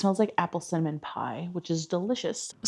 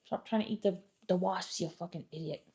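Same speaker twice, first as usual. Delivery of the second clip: muttered insult; 11 dB quieter than usual. Real, not acted. Muttering at dog.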